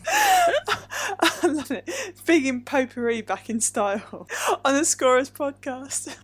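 high, loud words squeezed through real laughter; helpless laughter. Real, not acted. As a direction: crack up while talking. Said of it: "I should stop laughing".

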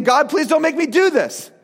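imitated panicked plea, pitched high; high mock-desperate prayer; crying out, pleading. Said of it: "he's crying out to God".

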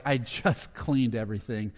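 mildly exasperated, worn-out grumble; weary frustration. Retold or imitated. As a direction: sigh it out like a man who caught nothing. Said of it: "he's just frustrated".

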